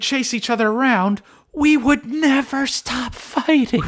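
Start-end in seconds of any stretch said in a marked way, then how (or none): 1.5-3.8 high, slow, stretched-out wail; drawn-out exaggeration. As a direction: drag every word out dramatically. Exaggerates the endless childhood fights.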